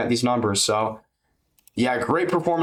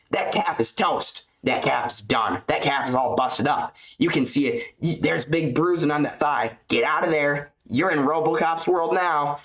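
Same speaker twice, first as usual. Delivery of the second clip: higher, slowed hyped commentator call; mock commentator savouring the calf damage; Rogan-style hype; 3 semitones higher than usual. Fan imitating a commentator gloating over the calf damage, higher and drawn out. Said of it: "And the one that goes".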